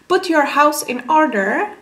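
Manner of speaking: ordinary talk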